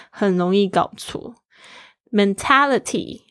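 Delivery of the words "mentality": Mentality slowly and higher, ta stressed. Shown as a demonstration. Teacher modelling Mentality stress, slow and higher. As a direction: hit ta in Mentality. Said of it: "重音在T上面".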